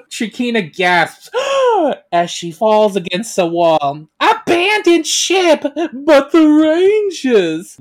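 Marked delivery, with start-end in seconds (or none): 1.3-2.1 acted gasp. Narration: Shirkina gasps. 4.2-7.8 high, loud, drawn-out command; urgent retreat order. Acted. Queen orders the crew to abandon ship, loud and alarmed.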